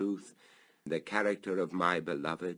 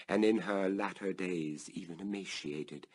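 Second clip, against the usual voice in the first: ordinary delivery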